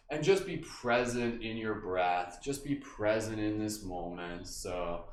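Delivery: slow, soft, low meditation voice; guided meditation. Acted. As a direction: ease them into stillness, slowly. Guided movement, slows and softens.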